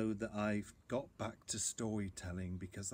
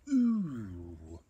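mumbling bear; 6 dB louder than usual. Acted. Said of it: "he mumbled".

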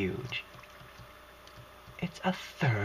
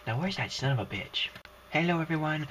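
ordinary talk

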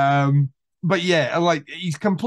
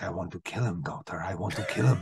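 dropped low and soft, quietly murderous; low menacing murmur; 8 semitones lower, 7 dB quieter than usual. Retold or imitated. Dreyfuss to his therapist, low quiet menace. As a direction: confide the wish to kill in a low hush.

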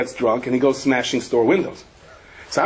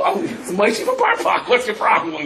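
Russian driver; 7 semitones higher than usual. Retold or imitated. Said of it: "He goes".

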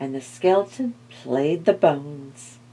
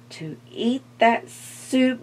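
plain reading voice

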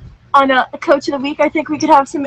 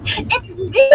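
excited squeal. Real, not acted. Coach bursts into the box.

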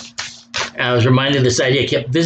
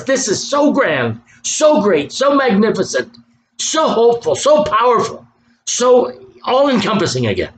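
high, building preacher's praise; exultant, high-pitched pulpit marvel; awed exultation; 9 semitones higher than usual. Real, not acted. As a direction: pile up each SO with rising fervor. Preacher exalting the promised deliverance.

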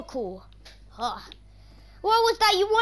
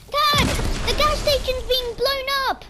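high, loud panicked calling for dad; panicked yell; 7 semitones higher, 3 dB louder than usual. Pretend. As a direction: scream for dad as the station blows up. Gas station exploding.

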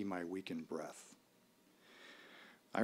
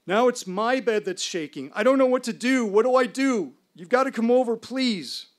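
much higher, louder, rapid frightened plea; panicked pleading; 13 semitones higher, 10 dB louder than usual. Retold or imitated. Roommate calling at night in panic, loud high frightened begging. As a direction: beg for help in terror, voice up high. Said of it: "in a blind panic".

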